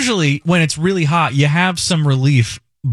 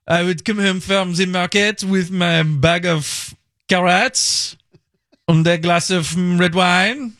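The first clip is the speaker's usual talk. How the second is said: slow, higher mock French accent; bad French accent; 4 semitones higher, slower than usual. Host playing his would-be French self, bad French accent. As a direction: play the cultured Frenchman, badly accented. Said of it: "this bad French accent".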